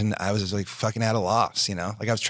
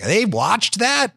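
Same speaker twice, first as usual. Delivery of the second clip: disbelief; 12 semitones higher, 7 dB louder, much slower than usual. Retold or imitated. Retold disbelief at SNL news.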